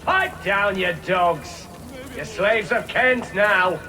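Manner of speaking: high, loud jeering bellow; shouted order. Acted. Guard silencing captives, shouted contempt. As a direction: yell them into silence.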